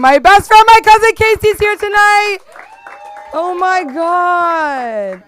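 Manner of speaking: high, loud squeal of joy; excited shoutout. Real, not acted. Hyping up her cousin in the crowd, a loud excited squeal.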